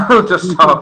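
chuckles mid-line while describing proud Scousers; laughing. Real, not acted. Amused while describing Scousers who won't hide their accent, laughing.

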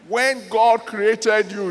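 usual speaking voice

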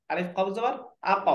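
ordinary delivery

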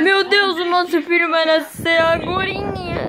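normal talking voice

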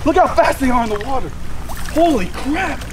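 normal talking voice

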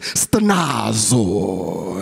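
"stenazo" long and low, like a Greek dish; playful Greek flourish. Shown as a demonstration. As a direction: serve up "stenazo" with drawn-out Greek flair. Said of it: "like you about to give some Greek, a Greek dish".

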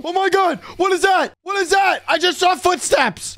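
high-pitched, frantic exclamations; startled panic. Acted. Ghost hunter spotting footsteps on camera, startled and high-pitched. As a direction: blurt it out in high-pitched alarm.

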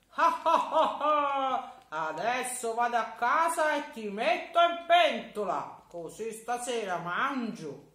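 high, loud mock laugh then boast; a greedy giant crowing over his catch; gloating giant. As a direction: giant laughing at the trapped boy. Giant gloats carrying boy.

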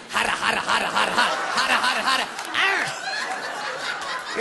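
hammed-up frenzied sputter; frenzied gibberish noises. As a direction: babble wildly like a crazed grandma. Grandma raving in imitated noises.

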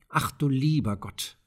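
softly voiced cry of dismay; dismayed exclamation. A narrator's reading. Maren seeing her father's flooded meadows, softly dismayed. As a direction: exclaim with hushed, understated alarm. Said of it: "rief Maren".

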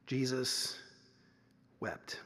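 a low, grieving near-hush, letting the verse sink in; Jesus wept, read low and very soft. Acted. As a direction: drop almost to nothing on wept. Solemn reading of the verse.

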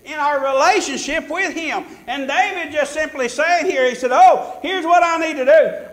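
high, loud preacher's cadence; fervent preaching. Real, not acted. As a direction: preach it out with rising fervor. Preaches at full force.

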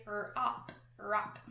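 "rop" said with a short O, as in "hop". Shown as a demonstration. Teacher shows rope without its magic E. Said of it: "If there wasn't that magic E".

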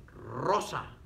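"Rosa" with a strongly rolled first R; R inicial fuerte. Shown as a demonstration. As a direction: roll the R that opens "Rosa". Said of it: "Al principio suena fuerte". ("Rosa" con erre inicial bien vibrada)

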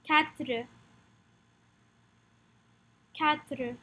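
French "Quatre", said "katr" with a light final r; "Quatre" modelled in French for learners; French pronunciation drill. Shown as a demonstration. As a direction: say "Quatre" with a k sound, no w. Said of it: "Practice the pronunciation with me".

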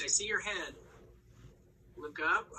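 ordinary talk

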